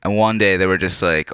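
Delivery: normal talking voice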